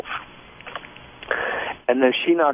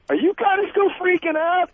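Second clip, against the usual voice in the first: mimicking wife. Wife calling from other room.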